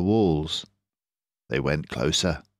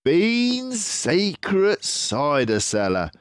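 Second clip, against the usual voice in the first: higher, slow, knowing fox voice; Mister Fox; 12 semitones higher, slower than usual. Acted. Mister Fox names Bean's cellar, proud. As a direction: reveal it proudly.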